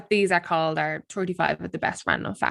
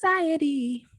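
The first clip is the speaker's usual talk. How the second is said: mock farewell; 11 semitones higher, 7 dB louder than usual.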